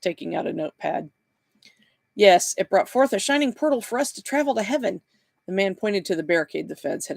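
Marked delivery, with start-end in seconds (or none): 2.2-5.0 high-pitched voice of an earnest believer. A narrator's reading. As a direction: testify to a miracle, pitched up.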